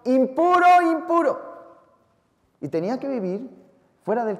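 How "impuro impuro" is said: high, loud cry of impuro; leper's shout. Pretend. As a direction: call out impuro like a leper warning people away. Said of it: "y gritando".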